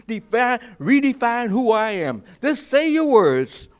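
high, loud, ringing sermon delivery; a preacher declaring his resolve to the congregation, loud and high; full-force preaching. Real, not acted. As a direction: preach it out with defiant conviction.

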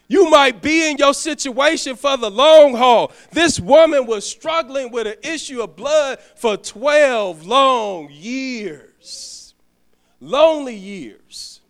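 loud, building sermon cry; preaching at full force. Acted. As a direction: drive it home to the congregation. Preaching climax.

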